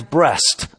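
BREAST said with "st" in place of "th"; mispronounced syllable. Retold or imitated. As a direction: slip into "breast" where breath is meant. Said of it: "I messed up on one little syllable".

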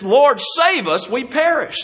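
panicked disciples begging the Lord; frightened plea. Acted. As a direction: plead like men about to drown. Disciples cry out in storm.

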